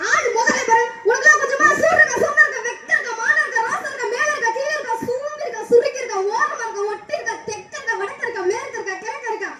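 memorised sing-song scolding. Real, not acted. Girl rattles off insults at the man, sing-song scolding. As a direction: chant the scolding like a song learnt by heart. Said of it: "மனப்பாடம் பண்ணி பாட்டு பாடின மாதிரி".

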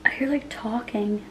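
hushed, afraid whisper; real whisper. Real, not acted. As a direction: whisper so nobody outside hears. Scared whisper in hotel room.

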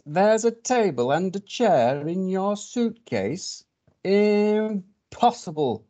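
high, drawn-out disbelief; incredulous fox. Acted. Fox hearing of table and chair in suitcase, incredulous. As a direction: stretch each word out as if it cannot be true.

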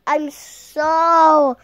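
ordinary delivery

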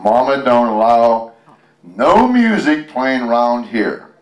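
slow, drawn-out read of the title; song title read aloud. A narrator's reading. Parent reading the song title to a child, slow and drawn out. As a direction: savour each word like a song name.

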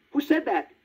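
put-on high, startled puppet boy asking; a scared little wooden boy calling out; frightened. A narrator's reading. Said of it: "asked Pinocchio in a frightened voice".

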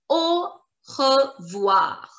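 slow Au, re, voir with gaps; syllable by syllable. Shown as a demonstration. Teacher introduces bye-bye in French, Au revoir slowly split. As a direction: break Au revoir into slow separate sounds. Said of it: "and we pronounce it".

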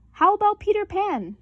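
high, loud eager question; eager kid suggestion. A narrator's reading. Brother proposing Peter Pan to his sisters, high and eager. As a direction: pitch the game like a kid.

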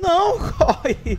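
slow, loud yell through laughter; laughing yell. Real, not acted. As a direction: shout while cracking up. Laughing yell during chase.

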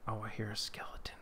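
quick hushed whisper; tense whisper. Real, not acted. Hearing a skeleton nearby, tense whisper. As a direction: whisper so the skeleton won't hear.

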